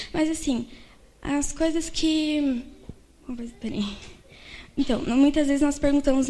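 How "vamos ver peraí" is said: whispered aside. Real, not acted. Speaker loses her place.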